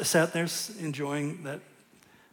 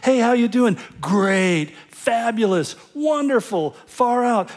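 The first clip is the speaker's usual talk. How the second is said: breezy small talk; 5 semitones higher, 6 dB louder, slower than usual. Retold or imitated. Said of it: "isn't that how it goes?".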